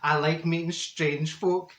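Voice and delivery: ordinary delivery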